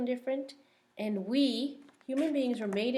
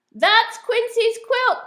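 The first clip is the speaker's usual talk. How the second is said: high, loud, slow quacking shout; loud duck shout; 13 semitones higher, 13 dB louder, slower than usual. Acted. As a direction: sound the alarm like a loud duck. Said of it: "quacks Dottie".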